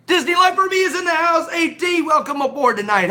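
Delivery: high, loud MC welcome; excited announcement. Real, not acted. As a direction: call the name out like a game show host. Announcing a viewer arrival.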